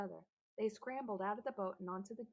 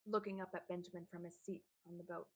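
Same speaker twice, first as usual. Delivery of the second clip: ordinary delivery, nothing marked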